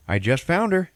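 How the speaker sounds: lightly voiced husband, pitched high and loud; husband's joking reply. Husband answers wife about the coffee maker, joking high voice. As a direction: raise it into a cheeky comeback.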